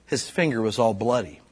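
ordinary talk

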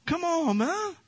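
high-pitched, slowed mock protest; mock indignant retort. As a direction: playfully defend the wife, voice jumping up. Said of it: "I'm like".

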